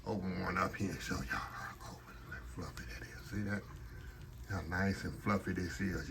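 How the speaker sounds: hushed whisper to the camera. Real, not acted. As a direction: breathe it out in a whisper. Breaking a fritter open close-up.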